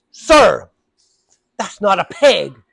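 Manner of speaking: loud stern slow rebuke; sharp rebuke. Retold or imitated. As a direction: snap at him firmly. Lady losing patience.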